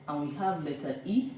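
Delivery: normal talking voice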